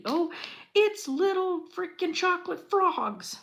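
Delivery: usual speaking voice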